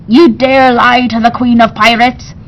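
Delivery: loud, menacing pirate queen; angry pirate queen. A narrator's reading. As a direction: threaten him with a cutlass.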